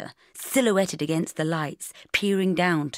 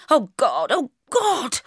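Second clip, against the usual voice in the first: mock panic; 9 semitones higher, 5 dB louder, slower than usual. Panicking before date.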